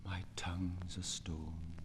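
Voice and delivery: hushed whisper, almost voiceless. Acted. Poet reciting, whispering of his silenced tongue. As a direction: let the words die into a whisper.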